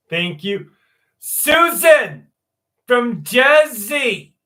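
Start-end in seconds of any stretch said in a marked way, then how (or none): 1.2-4.3 put-on loud, slow, drawn-out Jersey accent. Streamer greeting chat in a loud Jersey accent. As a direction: belt it out in a thick New Jersey accent. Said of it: "That's my best Jersey accent".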